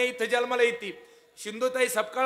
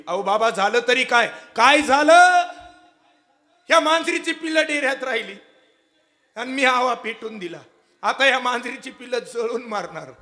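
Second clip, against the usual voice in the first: anguished plea; 3 semitones higher, 8 dB louder than usual. Acted. Father and daughter dialogue.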